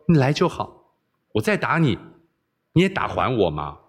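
usual speaking voice

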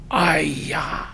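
breathy unvoiced 哎呀 of shame; a hushed, mortified exclamation; embarrassed whispered sigh. Preacher recalling being caught out, hushed sigh. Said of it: "说的我是面红耳赤啊".

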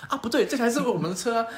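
sudden realization. Retold or imitated. Narrator realizing the car is theirs, high startled exclamation.

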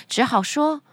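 ordinary delivery, nothing marked

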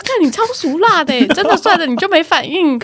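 teasing sister. Retold or imitated. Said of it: "那我妹就说".

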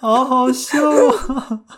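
high, slow, laughing remark; amused laughing. Real, not acted. Host reacting to the photo story, laughing. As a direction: let the amusement spill out.

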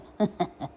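short soft chuckle; laugh. Real, not acted. Narrator amused by own shouting, chuckling.

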